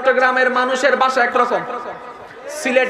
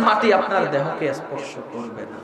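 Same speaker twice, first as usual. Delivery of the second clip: solemn low promise; 11 semitones lower, 7 dB quieter than usual. Acted.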